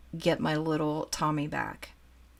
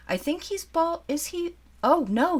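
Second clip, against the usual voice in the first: surprised; 10 semitones higher than usual. Real, not acted. Unwrapping the doll, discovering hair.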